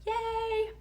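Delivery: high-pitched drawn-out yay; a small, high cheer held out long; playful little cheer. Real, not acted. Playful cheer after promoting link.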